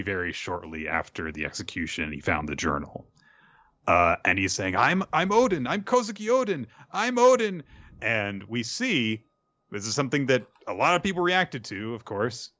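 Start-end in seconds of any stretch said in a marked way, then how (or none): 4.8-7.6 put-on much higher, louder small child insisting; little kid's voice. Young Yamato telling Kaido who he is, high child voice. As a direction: pipe it up like a tiny kid claiming a name. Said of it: "And he's only little".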